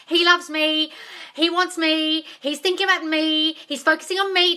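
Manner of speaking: high, loud gushing over him; giddy lovesick high voice; needy mock voice. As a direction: squeal it like you're obsessed with him.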